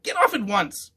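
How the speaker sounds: high loud witch shout; angry witch. A narrator's reading. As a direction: snap the order like a furious witch. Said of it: "witch ordering intruder off".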